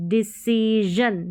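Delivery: डिसीजन slowly, ending in voiced zhun. Shown as a demonstration. Teacher correcting the wrong reading, डिसीजन slowly with zh. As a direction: stretch see, then zhun in डिसीजन. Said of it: "डिसीजन नहीं".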